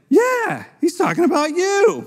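high mocking reply to a nervous friend; mock neighbor. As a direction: pitch up high and needle your neighbor. Neighbor confirms to the leader.

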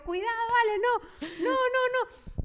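high, anxious warning, slowing on the no's; retold plea to a child about to fall; alarma de madre. Retells alarm as child slips. (súplica aguda y alarmada)

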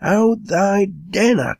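high, drawn-out interruption; crying out. A narrator's reading. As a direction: cut across him with a slow high cry. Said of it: "cried Rip".

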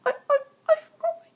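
very high, loud crying voice; high, loud, tearful cry; stolen voice. Acted. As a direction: wail it in a squeaky high voice. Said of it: "whose voice I'm stealing".

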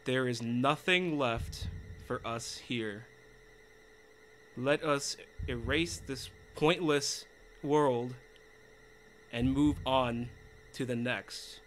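low, slow, menacing read; villain voice. Acted. Player reading the villain's lines in a slow menacing voice. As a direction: intone it like a cold dark child.

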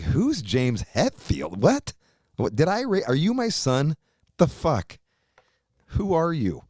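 slow, high disbelief building to outrage; incredulous. Retold or imitated. Father reacts to son not knowing [name], incredulous.

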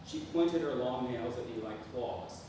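ordinary talk

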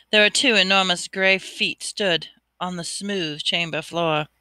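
usual speaking voice

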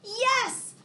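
high bright exclaimed yes; thrilled little cheer of yes; excited yes. Acted. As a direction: burst out with the answer like a reveal. Answers refrain excitedly.

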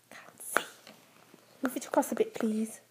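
softly whispered request. Real, not acted. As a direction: whisper so the story isn't broken.

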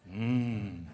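normal talking voice

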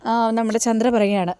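ordinary delivery

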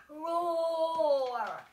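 loud animal roar; big booming roar. Acted. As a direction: roar like a hungry beast.

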